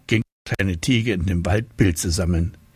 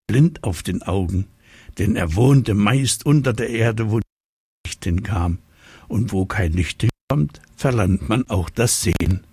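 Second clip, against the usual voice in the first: ordinary delivery, nothing marked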